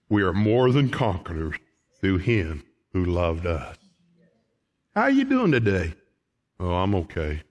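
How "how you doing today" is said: bright, high church-door greeting; upbeat hello at the church door; cheery greeting. Acted. Preacher acts greeting exchanges.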